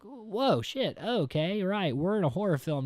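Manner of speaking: high-pitched whoa, taken aback; mock startled realization. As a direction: act out a viewer caught off guard. Acts out audience's startled realization.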